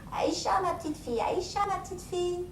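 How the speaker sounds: lightly voiced grandfather; grandfather answering. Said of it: "grand-père répondait".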